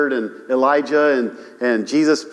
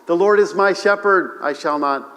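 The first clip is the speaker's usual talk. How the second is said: reverent psalm quote; 4 semitones higher than usual. Retold or imitated. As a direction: let the verse settle down. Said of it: "we can almost quote the twenty-third Psalm".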